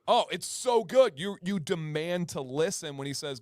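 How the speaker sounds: normal talking voice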